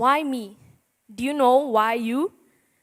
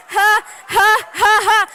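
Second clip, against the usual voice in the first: high, loud hammed-up laugh at her own joke; a big fake ha-ha to sell the pun; forced laugh; 9 semitones higher, 8 dB louder, slower than usual. Teen preacher laughing loudly at her own pun.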